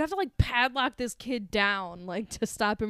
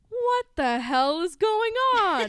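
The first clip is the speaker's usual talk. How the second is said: exclaiming; 6 semitones higher, 5 dB louder, slower than usual. Retold or imitated. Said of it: "Reg is like".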